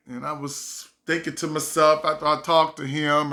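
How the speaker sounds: usual speaking voice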